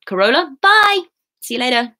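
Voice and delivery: high, loud sing-out farewell; excited goodbye. Real, not acted. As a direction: call bye brightly across to a friend. Calls goodbye to viewer.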